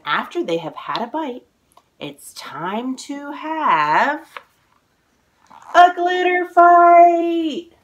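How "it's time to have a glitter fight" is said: slow build to a loud, high shout; building excitement. A narrator's reading. Author reading to kids, building to an excited shout. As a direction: stretch it out then burst with glee.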